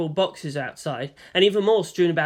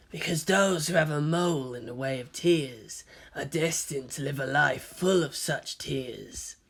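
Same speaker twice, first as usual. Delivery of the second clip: softer, slow intimate murmur; breathed into her ear; 2 semitones lower, 5 dB quieter than usual. A narrator's reading. Kaji breathes into Ritsuko's ear, softer and slow. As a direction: lean in and breathe it. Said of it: "he breathed into her ear".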